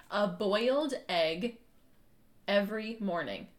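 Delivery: shape each word slowly and clearly; over enunciating. Shown as a demonstration. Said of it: "hear you whispering a little bit".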